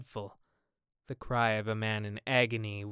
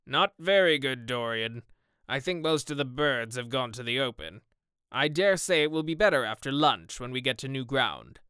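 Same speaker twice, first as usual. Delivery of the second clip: emphatic hearty shooting companion; loud opening, then brisk sporting chat; a hearty gentleman out shooting; Sir Geoffrey, hearty sportsman; 5 dB louder than usual. Acted. Voices Sir Geoffrey beside narration.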